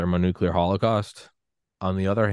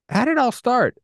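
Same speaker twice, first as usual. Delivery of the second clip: much higher, squeaky mock question; mock survivor question; 5 dB louder than usual. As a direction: ham up a bewildered survivor asking. Survivors asking in the future.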